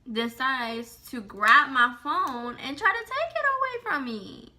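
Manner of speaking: ordinary talk, nothing marked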